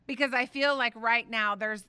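normal talking voice

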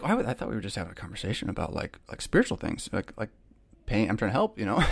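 soft, half-whispered mock confusion; quietly retelling his own puzzled reply; bewildered mumble. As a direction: mumble it like you're baffled they're angry. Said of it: "and I'm just like".

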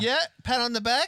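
high, loud "pat on the back"; mock hearty praise. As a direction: cheer it like a backslapping mate. Mock praise, higher, louder.

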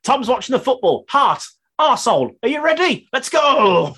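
high, loud pumped-up cheer; rally the team before kickoff; hyped-up heart. Acted. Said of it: "Just imagine it says".

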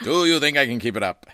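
loud radio announcer voice. Shown as a demonstration. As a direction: pump it up like old radio. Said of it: "do that voice for the rest of the day".

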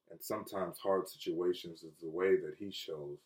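low, soft and solemn; hushed preaching. Real, not acted. Pastor telling listeners how God shows love, hushed preaching. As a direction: bring it right down, almost to a hush.

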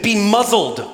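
a firm "Be muzzled" with the weight on "muzzled"; commanding rebuke. Acted. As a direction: order the demon to silence, falling on "muzzled". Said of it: "He says to the demon".

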